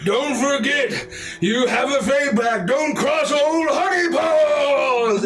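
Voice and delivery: slow, high roaring warning; roaring bear. Acted. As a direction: roar the threat out. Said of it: "he roars and says".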